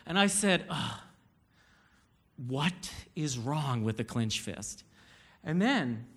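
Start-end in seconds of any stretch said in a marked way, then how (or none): 0.7-4.8 low, slow, restrained exasperation; irritated, defensive. Retold or imitated. Husband answering his wife's complaint, irritated and defensive. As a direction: hold the irritation down, soft and deliberate. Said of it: "I got irritated and defensive a little bit".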